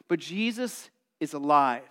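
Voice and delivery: usual speaking voice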